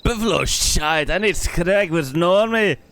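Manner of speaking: a light gruff Scottish grumble, slow; a hint of a grumpy Scotsman; Scottish grump. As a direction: grumble it like a grumpy old engineer. Grumpy NPC voiced.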